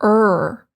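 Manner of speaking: er with a full American R, tongue curled; rhotic R sound. Shown as a demonstration. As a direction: say er with a strong curled R. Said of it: "The original English R was like".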